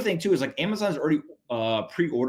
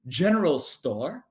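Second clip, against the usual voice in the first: prim prairie lady; 8 semitones higher than usual. Pretend. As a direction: play a dainty frontier lady asking for a ride. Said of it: "be back there with your hat and say".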